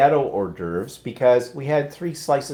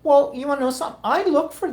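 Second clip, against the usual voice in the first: surprised; faster than usual. Real, not acted. Surprised at news about Amazon.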